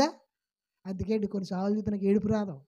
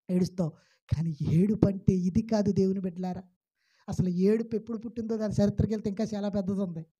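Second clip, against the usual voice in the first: ordinary talk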